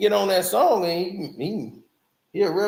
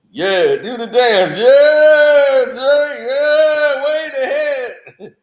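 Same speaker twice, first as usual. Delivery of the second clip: loud, higher cheering hype crowd; hype men; 4 semitones higher, 8 dB louder, much slower than usual. Retold or imitated. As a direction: hype him up like paid yes-men. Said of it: "They be like".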